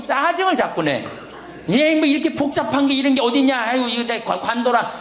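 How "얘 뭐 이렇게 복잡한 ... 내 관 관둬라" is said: high, fretful grandma grumbling over her phone; grumbling old grandma. Retold or imitated. Priest imitating a grandma annoyed by her phone, high grumble. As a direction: imitate a grandmother fed up with the gadget. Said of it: "이래 그냥 짜증을 자꾸 배".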